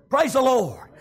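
usual speaking voice

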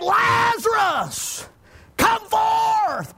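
high, long-drawn preacher's call; loud calling cry. Retold or imitated. Preacher voicing Jesus calling Lazarus from the grave, loud calling cry. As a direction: stretch each word out as if summoning the dead. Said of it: "he cried with a loud voice".

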